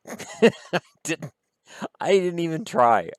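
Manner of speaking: laughing through the words; giggling while admitting it. Real, not acted. Laughing at own story.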